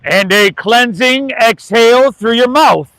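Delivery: yoga teacher leading a breath; guided breathing. Acted. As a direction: guide the class through each breath.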